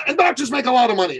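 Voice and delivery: quick, high mock voice; greedy client. Client admitting the money motive, high and fast. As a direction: rush out the real reason.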